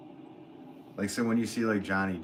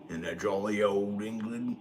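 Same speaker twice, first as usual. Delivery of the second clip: put-on Cockney-style British accent; mock British accent, lightly hammed up; bad British accent. Streamer teases his English viewer in a mock British accent. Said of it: "I'm really bad with the British accent".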